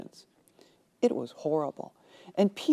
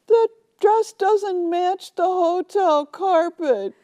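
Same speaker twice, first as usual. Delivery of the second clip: much higher, louder, slow whine; distraught bride; 11 semitones higher, 5 dB louder, slower than usual. Retold or imitated. Bride whining to Zola about the carpet. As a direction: drag out the complaint.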